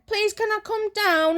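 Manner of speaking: high slow pleading call; shouting plea. Retold or imitated. Young self shouting down to mum again, high plea. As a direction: shout down the stairs begging. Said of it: "I shouted again".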